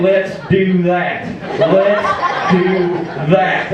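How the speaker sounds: slow Southern drawl with pauses; drawn-out catchphrase. Acted. Rusty rallies the audience with his catchphrase, slow drawl. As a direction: stretch it out and let it land.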